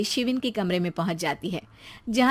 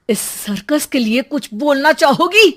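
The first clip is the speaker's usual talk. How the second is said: sarcastic father; 4 semitones higher, 9 dB louder than usual. Acted.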